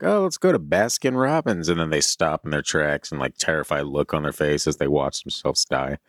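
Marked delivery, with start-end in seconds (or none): 0.0-1.7 cheerful casual voice. Retold or imitated.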